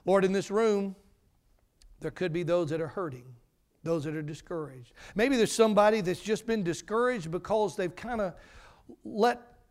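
soft, earnest prayer voice; closing prayer. Acted. Preacher prays over the congregation in a soft, earnest voice. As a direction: pray quietly over the room.

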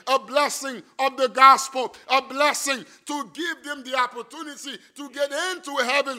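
loud, emphatic pulpit delivery, hammering key words; forceful preaching. Real, not acted. Preacher urging the congregation about God's blessing of salvation, forceful preaching.

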